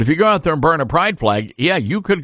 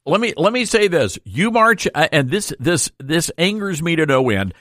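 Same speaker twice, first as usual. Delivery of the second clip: ordinary delivery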